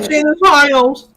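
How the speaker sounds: high, loud, slow squeal; squeaky high shout. Pretend. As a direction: squeal it out in delight.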